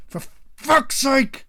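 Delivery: loud, high, drawn-out angry yell; father bellowing after being woken; furious outburst. Acted. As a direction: explode at the noise. Clav woken by row, swears.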